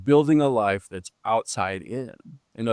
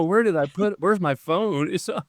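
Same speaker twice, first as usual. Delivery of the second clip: higher-pitched mock fluster; mock searching; 7 semitones higher than usual. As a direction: act out hunting for lost keys. Mocking a frantic search for purpose.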